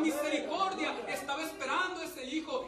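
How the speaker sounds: full-force pulpit preaching; fiery preaching. Acted. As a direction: preach it out to the congregation with rising force.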